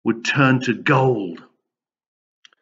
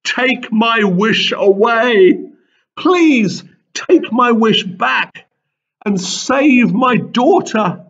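high, slow, louder desperate plea; begging; 8 semitones higher, 4 dB louder, slower than usual. A narrator's reading. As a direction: beg him to undo it, voice straining. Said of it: "the king begged".

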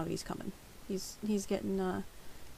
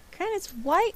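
pet-talk voice; 6 semitones higher than usual. Real, not acted. Talking to the hiccuping pet.

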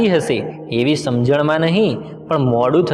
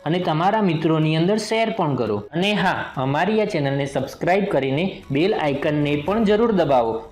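outro talk; 3 semitones higher, 4 dB quieter than usual. Real, not acted. The narrator asks viewers to like and subscribe, in a chatty sign-off. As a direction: wrap up and ask for likes. Channel outro after story.

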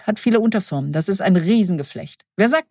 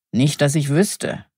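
a low, slow growl of a reply; grumbling reply; 5 semitones lower, slower than usual. A narrator's reading. Perdita growls at Rita, slow and low. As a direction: growl it out, unwilling to say more. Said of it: "knurrte Perdita".

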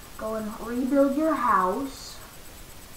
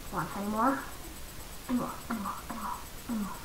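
unvoiced whispered chant; eager whisper; 2 semitones lower, 10 dB quieter, much faster than usual. Real, not acted. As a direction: whisper hopefully for more ore. Hoping for more iron.

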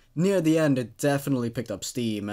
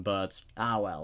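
usual speaking voice

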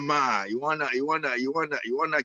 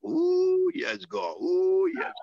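high ecstatic ooh; joyful ooh; 13 semitones higher, much slower than usual. Real, not acted. Pastor crying ooh in praise.

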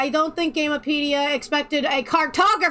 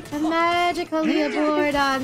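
Charlie the Unicorn quote; 5 semitones higher than usual. Retold or imitated. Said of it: "Charlie the Unicorn quote".

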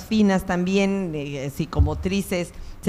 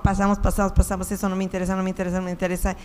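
rattled off very fast, brushing things aside; rapid skimming; much faster than usual. Retold or imitated. Teacher imitating readers who skim, rapid dismissive patter. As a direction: race through it like someone flicking past everything.

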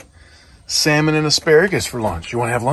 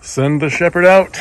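raised voice carrying across the yard; calling out; 6 semitones higher, 7 dB louder than usual. Real, not acted. Owner calling out to send the shepherd dog outside, raised voice. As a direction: call it out loud and high.

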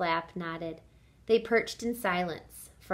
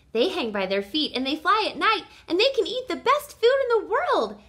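put-on high, loud, gushing child; excited explaining; 9 semitones higher, 7 dB louder than usual. A narrator's reading. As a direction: brag eagerly about your family. Young bat boasts to birds.

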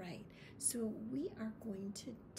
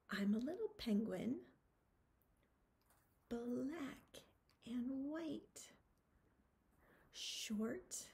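finger-play rhyme; much slower than usual. Acted. Recites penguin finger-play rhyme.

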